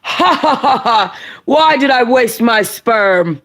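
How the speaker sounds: high mocking laugh then slow jeer; hammed-up scornful father; father's voice. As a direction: cackle and sneer as the cruel father. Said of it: "I had to find that father's voice".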